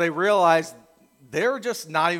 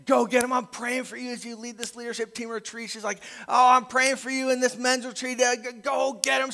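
cheery encouragement; 7 semitones higher than usual. Retold or imitated. Wife cheering him on.

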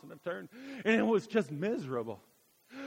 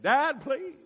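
put-on higher, louder childish plea; mock begging; 8 semitones higher, 6 dB louder than usual. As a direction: beg dad like a kid again. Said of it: "I begged and begged".

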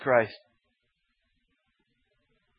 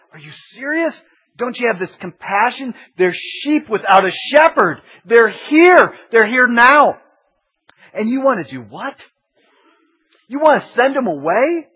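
high, incredulous and pleading; can't believe what you're hearing; indignant Jesus; 9 semitones higher, 9 dB louder than usual. Retold or imitated. Jesus answering the bean-counting disciples, high and incredulous.

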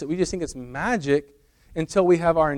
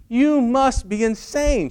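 high, loud, drawn-out exasperation; hammed-up incredulous outburst; mock anger; 10 semitones higher, 4 dB louder, slower than usual. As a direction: act the wife losing patience, stretching the words. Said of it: "She's getting very angry".

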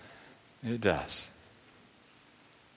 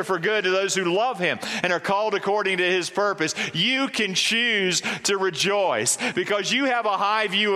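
rising, fervent run of "when you understand" lines, higher toward the end; preaching build; 7 semitones higher than usual. Acted. Pastor lifting the church toward joy, fervent preaching. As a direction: build the list up to a strong declaration.

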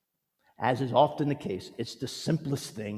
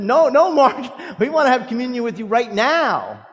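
insistent call; 12 semitones higher, 12 dB louder than usual. Retold or imitated. Pastor calling after Margaret in the parking lot, loud and insistent. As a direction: insist eagerly, drawing out the no.

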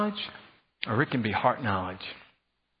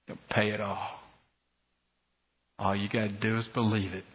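softer, hushed, reassuring promise; gentle voice of God; 5 semitones lower, 4 dB quieter than usual. Retold or imitated. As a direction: speak the promise tenderly, lightly voiced. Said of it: "who said".

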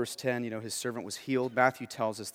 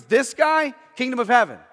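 Jesus proclaiming; 11 semitones higher, 14 dB louder than usual. Retold or imitated. Voices Jesus praising centurion.

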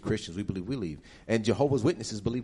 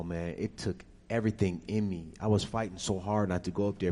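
ordinary delivery

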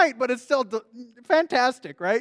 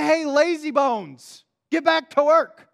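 mock scolding; 4 semitones higher, 5 dB louder, slower than usual. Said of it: "and say".

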